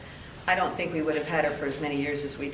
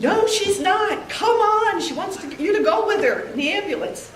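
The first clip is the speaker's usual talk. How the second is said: urgent pleading; 14 semitones higher, 5 dB louder than usual. Retold or imitated. Friends urging her, she is crying.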